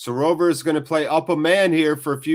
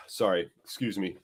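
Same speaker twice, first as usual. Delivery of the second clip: after a sneeze; 5 semitones lower, 7 dB quieter than usual. Real, not acted. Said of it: "I'm gonna sneeze".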